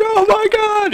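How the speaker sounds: very high, thrilled "oh my God"; excited squeal. Retold or imitated. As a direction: squeak it out in disbelief. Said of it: "it was just like".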